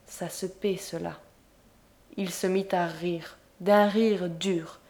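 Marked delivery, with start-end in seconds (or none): 0.1-1.1 low, soft murmur; quiet, menacing murmur. A narrator's reading. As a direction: murmur it coldly under her breath.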